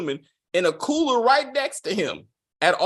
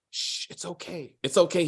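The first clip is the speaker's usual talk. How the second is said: soothing hush; 6 dB quieter than usual. Retold or imitated.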